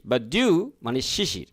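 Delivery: usual speaking voice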